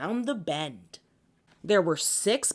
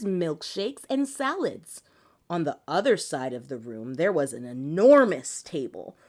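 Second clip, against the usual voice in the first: ordinary delivery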